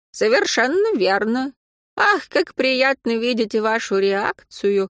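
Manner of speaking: put-on grand, theatrical old schemer; mustache twirling villain. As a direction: exclaim it with pompous pathos, rising high. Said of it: "пафосно воскликнул старичок". (пафосное восклицание злодея-старика)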